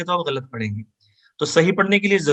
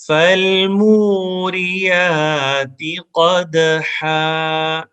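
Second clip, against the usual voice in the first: slow melodic chant, a bit higher; Quranic recitation; 4 semitones higher, 4 dB louder, much slower than usual. Shown as a demonstration. Teacher chanting the verse for students to repeat. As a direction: recite each verse slowly for repetition. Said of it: "آپ مجھے سنیں اور ... repeat کریں".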